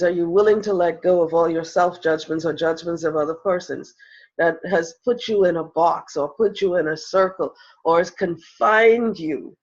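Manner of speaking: usual speaking voice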